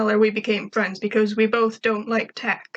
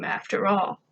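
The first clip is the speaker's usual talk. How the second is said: ordinary delivery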